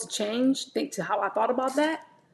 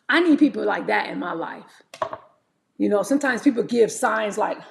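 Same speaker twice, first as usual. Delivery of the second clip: normal talking voice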